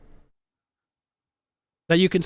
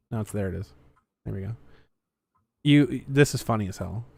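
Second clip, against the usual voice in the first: ordinary talk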